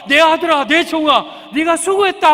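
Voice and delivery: loud, high proclaiming welcome; joyful welcome. Acted. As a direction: hail the faithful servant. Imagined Lord welcoming warmly. (높고 크게 기쁘게 맞아주듯)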